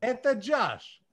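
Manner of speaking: put-on "Это Josh" in a light Russian accent. Greeting the listeners in mock Russian, light accent. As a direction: introduce yourself as a mock Russian. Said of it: "you should have said, "Eta Josh".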